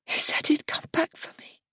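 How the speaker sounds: high soft unvoiced whisper. A narrator's reading. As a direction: whisper it, lost. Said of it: "she whispers".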